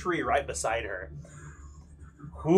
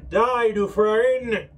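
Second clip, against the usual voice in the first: slow, louder, echoing through a helm; metallic voice filter; 5 semitones higher, 6 dB louder, much slower than usual. Acted. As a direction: bellow it as if muffled in steel. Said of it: "it'd be like a".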